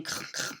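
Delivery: a throaty kr kr grunt; pig snort imitation. Shown as a demonstration. Teacher showing the snort she grew up with. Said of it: "I actually grew up, uh, saying".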